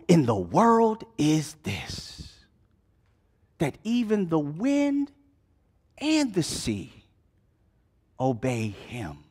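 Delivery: soft, slow whispered wonder; awed whisper. Acted. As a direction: hush it like you can't believe it. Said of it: "ask themselves".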